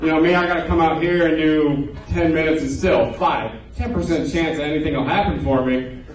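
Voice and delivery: ordinary talk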